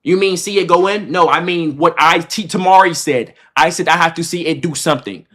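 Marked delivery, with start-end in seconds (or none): none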